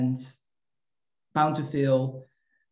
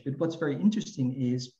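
usual speaking voice

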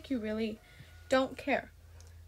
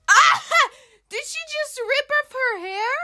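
shocked laugh; 11 semitones higher, 7 dB louder than usual. Real, not acted.

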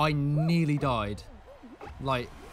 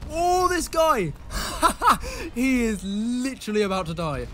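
high, loud laugh through the words; laughing disbelief; 6 semitones higher, 6 dB louder than usual. Real, not acted. Gamer watching a rival about to fall, laughing. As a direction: crack up at the doomed player.

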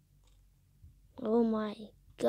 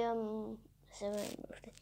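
whispered trail-off; 7 semitones lower, 20 dB quieter than usual. Real, not acted. Trailing off while reading.

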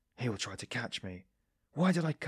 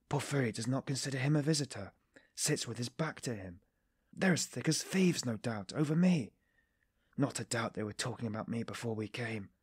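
plain reading voice

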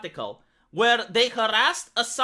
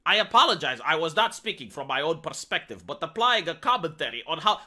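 mock corporate voice; 6 semitones lower than usual. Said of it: "who talks like this?".

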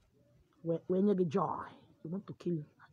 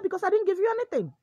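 much higher, fast protest: Because I didn't give you anything; indignant denial; 11 semitones higher, 5 dB louder, faster than usual. Acted. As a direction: fire it back high and quick. Doctor denies giving poison.

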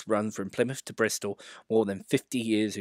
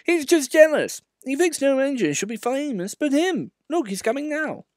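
put-on much higher, louder gentle old engine; Edward; 5 dB louder than usual. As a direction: confide it cheerfully.

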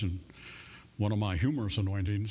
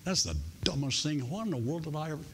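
embarrassed self-talk; 6 semitones higher, faster than usual. Retold or imitated. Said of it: "even though you think".

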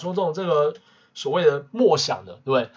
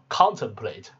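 contemplate with CON stressed; stress on first syllable; 3 semitones lower, slower than usual. Shown as a demonstration. Teacher asking whether it reads this way, first-syllable stress in contemplate. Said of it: "重音就变成第一个音节了".

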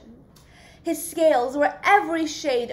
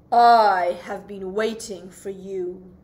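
low, slow and looming; deep octopus voice; 6 semitones lower, 5 dB louder than usual. Acted. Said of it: "said the octopus with a deep voice".

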